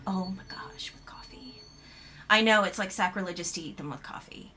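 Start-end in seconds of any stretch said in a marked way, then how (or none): 0.1-1.6 breathy whisper of pleasure; whispered delight. Real, not acted. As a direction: hush it like a guilty treat. Savouring the biscuit indulgence.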